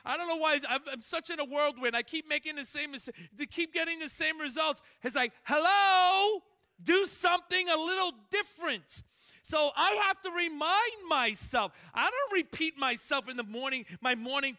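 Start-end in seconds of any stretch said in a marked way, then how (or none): 5.4-9.0 loud, high exasperation; exasperated hello. Retold or imitated. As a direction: snap at yourself. Exasperated self-talk.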